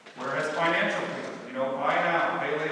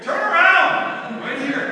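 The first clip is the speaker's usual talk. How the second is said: much higher, louder, slow yell; urgent shout; 14 semitones higher, 13 dB louder, slower than usual. Retold or imitated. Warning the aunt near the gravel pit, shouting.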